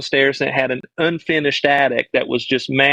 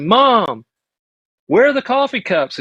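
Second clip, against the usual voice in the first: put-on child asking mom; 7 semitones higher than usual.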